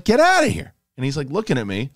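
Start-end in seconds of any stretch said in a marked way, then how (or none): 0.0-0.7 high, loud order to get out; half-shouted shoo at the squirrel; shooing yell. Retold or imitated. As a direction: chase the squirrel out with a sharp yell. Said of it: "And I'm like".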